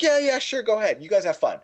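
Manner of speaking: high, breezy brush-off; mock-casual high dad voice; careless dad. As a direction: toss off the permission without a care. Dad casually waving them off again.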